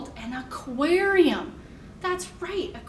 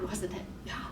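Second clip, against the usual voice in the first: ordinary talk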